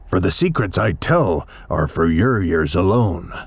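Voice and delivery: put-on low, muffled old hermit through a hose; lightly voiced grumbling old Once-ler; Once-ler's muffled voice. A narrator's reading. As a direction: grunt it out low like a hidden old recluse. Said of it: "he sounds as if he had smallish bees up his nose".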